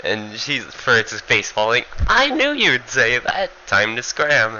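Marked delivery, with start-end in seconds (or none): 2.1-4.6 much higher, slow sing-song inner voice; a smug trickster who saw it coming; high, drawn-out cheeky thought before running; Furita thinking. Retold or imitated. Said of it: "in his head".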